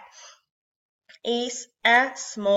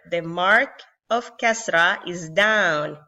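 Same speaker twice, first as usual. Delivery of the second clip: usual speaking voice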